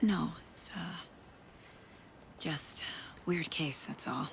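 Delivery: soft, hesitant whisper; whisper, brushing the worry aside; hushed reply to her husband, playing it down. Hiding stress, hushed phone reply.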